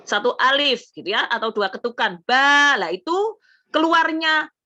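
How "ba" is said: "Ba" with the a held long and open; louder, stretched "Ba"; teacher modelling a long "Ba". Shown as a demonstration. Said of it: "panjang A-nya itu tadi".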